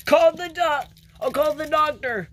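high, loud call for help; urgent alarm. Acted.

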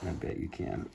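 soft breathy aside; whispered. Real, not acted. Aside to listeners, unvoiced.

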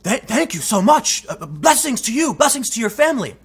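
lightly voiced grateful cab driver. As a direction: gush your thanks like the stunned cabbie. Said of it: "He turned to me and said".

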